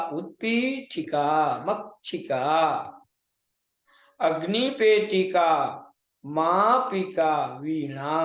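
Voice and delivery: slow, stretched-out syllables; pronunciation drill. Shown as a demonstration. Teacher modelling the listed words for students, slow clear pronunciation. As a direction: enunciate each word separately, holding the long vowels. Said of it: "जितने पद हैं सबका उच्चारण करिए".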